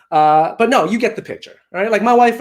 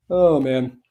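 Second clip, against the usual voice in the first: usual speaking voice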